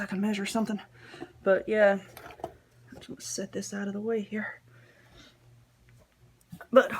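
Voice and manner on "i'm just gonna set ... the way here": whispering. Real, not acted. Whispered aside while working.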